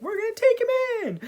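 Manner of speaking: high, fast, put-on lady voice; Pharaoh's wife voice. Pharaoh's wife taking in baby Moses, high put-on voice. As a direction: squeak it out like a royal lady.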